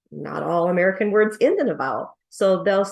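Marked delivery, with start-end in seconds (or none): none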